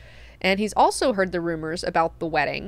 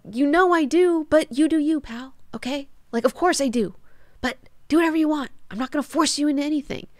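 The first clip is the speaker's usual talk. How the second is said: higher-pitched, offhand acted reply; breezy reassurance; 6 semitones higher than usual. Leto telling Duncan to do what he wants, breezy. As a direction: brush it off in a lightly put-on voice.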